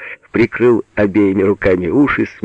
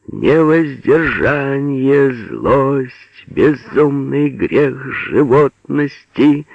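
slow, high declamation with stretched vowels; solemn recitation; 6 semitones higher, much slower than usual. A narrator's reading. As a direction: intone it like grand verse. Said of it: "начал торжественным голосом". (торжественная декламация нараспев)

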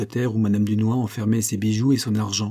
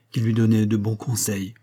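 usual speaking voice

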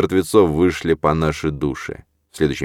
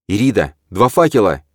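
louder, pointing urgently; urgent call; 4 semitones higher, 6 dB louder than usual. A narrator's reading. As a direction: shout the discovery. Calling out in chase.

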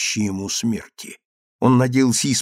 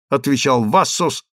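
plain reading voice

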